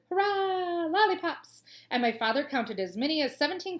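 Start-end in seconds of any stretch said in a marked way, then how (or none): 0.1-1.6 high, loud group cheer; cheering chorus. Acted. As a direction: shout it out like a crowd of crocodiles. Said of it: "A whole chorus of voices shouted".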